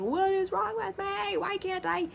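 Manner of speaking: high, pleading self-questioning; anguished inner voice. Retold or imitated. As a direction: ask yourself desperately why. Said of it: "I've been thinking".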